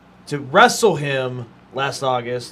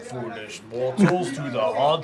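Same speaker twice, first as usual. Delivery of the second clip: slow, lower spooky welcome; haunted mansion host; 3 semitones lower, 4 dB louder, much slower than usual. Acted. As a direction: greet the guests like a ghost host. Quotes Haunted Mansion ghost host.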